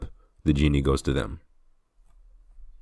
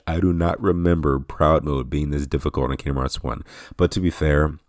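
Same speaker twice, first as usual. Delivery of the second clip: ordinary talk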